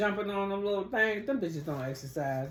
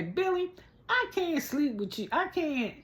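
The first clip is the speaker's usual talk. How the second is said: mock pleading in a high pitch; high-pitched put-on pleading; role-play plea; 10 semitones higher than usual. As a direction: imitate her rehearsing a plea, pitched way up. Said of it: "Okay, we'll role play".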